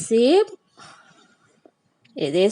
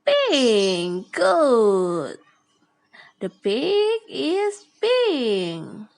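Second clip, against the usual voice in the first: usual speaking voice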